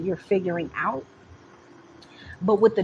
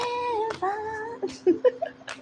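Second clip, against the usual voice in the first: laughing. Real, not acted. Laughs after singing.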